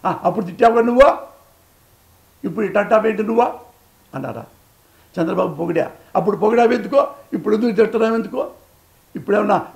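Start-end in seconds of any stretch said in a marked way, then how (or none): 0.0-3.6 louder, higher mock jeer; mocking taunt. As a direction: throw the taunt back at him. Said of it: "నీలాగా మాట్లాడలా". (ఎగతాళిగా, గట్టిగా నిలదీయడం)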